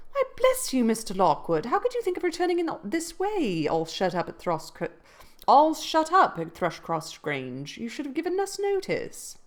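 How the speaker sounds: high, surprised exclamation; surprised cry. Acted. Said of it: "she jumped to her feet, crying".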